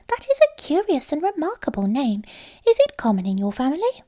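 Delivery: a small house mouse welcoming her friend home; little mouse voice. A narrator's reading. Mouse questions the name.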